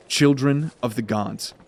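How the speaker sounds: usual speaking voice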